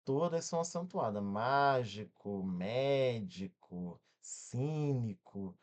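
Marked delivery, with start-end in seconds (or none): 1.2-5.6 mágico, médico, cínico said slowly, each first syllable held long; lower, measured list stretching MÁ, MÉ and CÍ; stress on first syllable. Shown as a demonstration. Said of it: "aquela que tem a antepenúltima mais forte". ("mágico", "médico", "cínico" com a primeira sílaba alongada)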